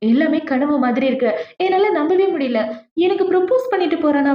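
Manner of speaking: lightly acted astonishment; retold shock. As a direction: act out her stunned wonder, pitch climbing. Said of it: "இவ shock ஆயிட்டு இருக்கா".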